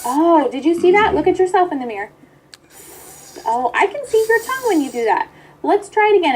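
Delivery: high encouraging teacher voice; coaching a child. Pretend. Therapist pretending a child's turn, encouraging. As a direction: gently point out the mistake to the child.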